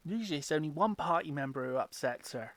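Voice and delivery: ordinary delivery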